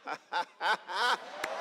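a short, delighted laugh; joyful laugh. Real, not acted. Preacher laughs with joy before his congregation, delighted laugh.

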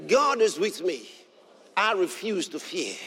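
ordinary talk, nothing marked

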